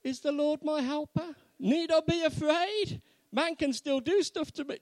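loud, high-pitched querying; exaggerated doubt, pitched right up; mock doubtful questioning. Shown as a demonstration. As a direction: question it as if unconvinced. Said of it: "When we say it with confidence, rather, is".

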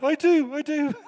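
high, loud, excited yes; eager squeal. Retold or imitated. The brother eagerly accepting Christ from the pastor. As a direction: blurt it out eagerly.

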